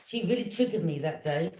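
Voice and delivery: ordinary delivery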